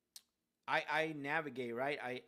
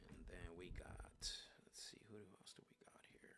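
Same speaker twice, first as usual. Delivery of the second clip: whispered to himself; whispering while scanning the list; 6 semitones lower, 14 dB quieter than usual. Real, not acted. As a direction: mutter it under your breath. Muttering to himself between calls.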